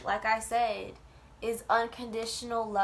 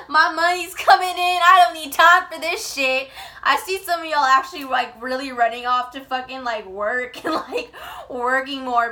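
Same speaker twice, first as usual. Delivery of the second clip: loud, high, laughing through words; 5 semitones higher, 15 dB louder than usual. Real, not acted. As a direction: talk while cracking up. Laughing at own joke.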